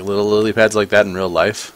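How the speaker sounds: ordinary talk, nothing marked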